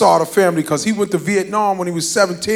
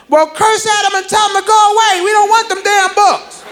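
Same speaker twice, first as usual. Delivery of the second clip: mom yelling back; 11 semitones higher than usual. Retold or imitated. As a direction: shout orders from another room. Mom answering from inside.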